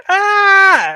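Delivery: high mock scream; Nessa's delayed scream. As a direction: imitate a shriek that comes far too late. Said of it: "five minutes later, she's like".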